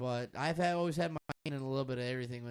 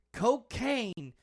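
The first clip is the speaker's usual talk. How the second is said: a hint of the senator, slow and a touch louder; imitating the senator; 4 semitones higher, 8 dB louder, slower than usual. Mimicking the senator's line to a friend, lightly voiced. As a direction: quote him slowly, lightly voiced. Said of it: "He said".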